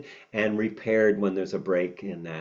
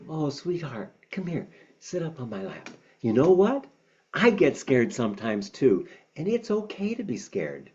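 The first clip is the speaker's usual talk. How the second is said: tender comfort; 4 semitones higher than usual. Acted. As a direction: hush the child onto your lap gently. Father comforting scared child.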